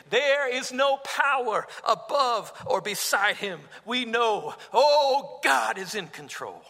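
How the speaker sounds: loud, high-pitched preaching climax; fervent preaching. Acted. Preacher proclaiming God's control to the congregation, fervent and loud. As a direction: proclaim it from the pulpit with full force. Preaching at full force opening.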